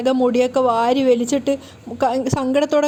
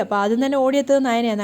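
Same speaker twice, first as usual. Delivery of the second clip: ordinary delivery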